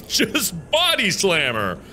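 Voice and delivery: usual speaking voice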